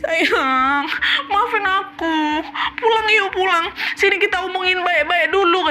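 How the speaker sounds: high tearful pleading; crying wife. Acted. Wife crying on the phone begging husband to come home, tearful and high. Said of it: "Tenangis-nangis istri aku di situ".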